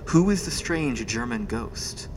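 low, measured suspense narrator; low-pitched serial announcer teasing the next episode; dramatic episode outro. Acted. Game master reads closing narration.

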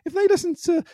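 lightly voiced Marie, quick and high; quoting Marie.

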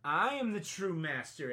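ordinary delivery

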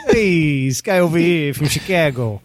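hammed-up Italian New Yorker; mock New York wise-guy ribbing; New York accent. Illustrator ribbing the Chicago guy, New York accent. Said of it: "like, a New York accent".

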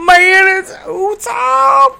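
stretched-out high greeting; drawn out, delighted to hear her; slow surprised greeting. Acted. GM NPC greets caller warmly.

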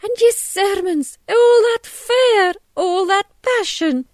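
high, loud, drawn-out indignation; betrayed wife in full fury; outraged accusation. A narrator's reading. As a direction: hurl each phrase, stretched and high. Missus Hughes accuses the false minister.

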